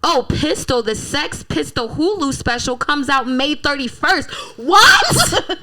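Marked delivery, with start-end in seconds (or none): 4.6-5.6 high, loud, laughing what; incredulous laughing shout; shocked what. Real, not acted. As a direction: shriek it in disbelief. Disbelief at more coincidences.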